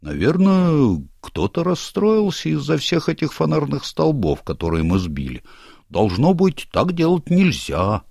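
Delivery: gnome leader voice. Acted. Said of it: "предположил Ринсмангл".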